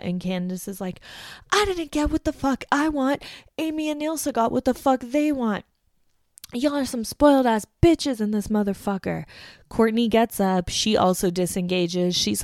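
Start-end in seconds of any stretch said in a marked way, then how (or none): 1.5-9.2 much higher, imitated shouting rant; mock angry yell. Candace yelling at the housemates, imitated rage. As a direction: play a housemate screaming at everyone.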